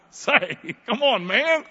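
hammed-up "really?"; preacher mocking the excuse in disbelief. Mock incredulous outburst.

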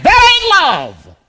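preacher bellowing the point home; loud. Real, not acted. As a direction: shout it down like a preacher. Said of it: "Boy, I'm loud today".

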